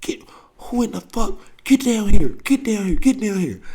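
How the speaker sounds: high mock yell down the stairs; hammed-up screaming uncle; retold uncle scream. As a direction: summon the kids like a furious uncle. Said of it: "My uncle is screaming".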